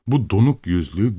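usual speaking voice

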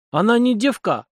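high-pitched, offended protest; indignant. A narrator's reading. Young man defending his beloved to the captain, indignant. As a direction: defend her honour, voice pitched right up. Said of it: "возмутился гордый сын корейского народа".

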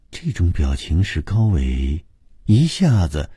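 usual speaking voice

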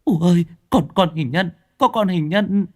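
high, breathless frightened stammer; panicked girl. Acted. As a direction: blurt it out in fear. (lắp bắp sợ hãi)

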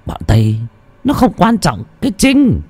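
leering, boastful threats with high swoops; a lightly voiced tyrant bragging and threatening; menacing village chief. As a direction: gloat over your power to kill. Lecherous village chief threatens girl.

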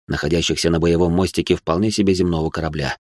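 ordinary delivery, nothing marked

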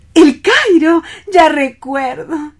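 light up at the name of the city; excited recognition. Acted. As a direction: singer suddenly remembering Cairo.

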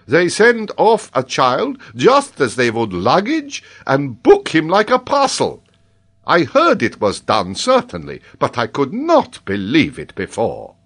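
high, loud character voice, exclaiming; excitable man sounding off; indignant Paganel. A narrator's reading. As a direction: protest loudly in a high, animated voice.

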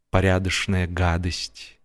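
plain reading voice

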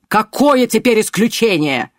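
high, loud angry bark. A narrator's reading. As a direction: bark it at him. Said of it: "рявкнул Пак".

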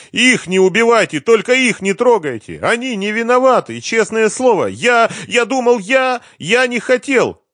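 very high, loud wailing plea; desperate high-pitched wail; tearful begging. A narrator's reading. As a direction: beg frantically, building towards tears. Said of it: "Он заплакал".

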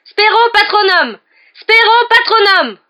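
high, loud, drawn-out incantation; yelled spell. A narrator's reading. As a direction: yell the spell at full voice. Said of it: "hurla Harry". (sortilège hurlé)

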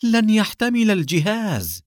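high, slow, stretched-out cry; shouted warning. A narrator's reading. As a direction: cry a desperate warning to your partner. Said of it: "صاح حسان". (صيحة تحذير عالية)

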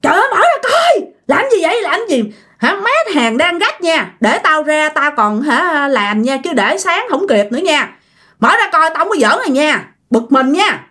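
high-pitched fed-up complaint, not truly shouted; acted-out annoyed outburst; exasperated yelling, acted. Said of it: "bạn mới bực quá, bạn mới la".

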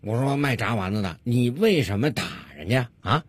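understated police officer voice; lightly voiced policeman. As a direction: question the vendor as a policeman, quietly.

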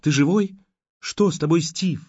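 frightened asking. A narrator's reading.

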